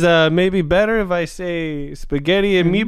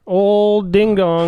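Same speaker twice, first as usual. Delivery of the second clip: silly slow voice; 4 semitones higher, 5 dB louder, much slower than usual. Retold or imitated. Announces with silly voice.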